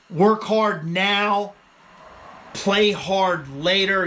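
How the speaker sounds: each word spaced out and weighted; slow, deliberate advice; slow emphatic motto. Real, not acted. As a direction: drive the motto home slowly. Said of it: "motto delivered slowly".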